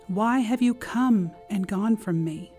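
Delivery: ordinary talk, nothing marked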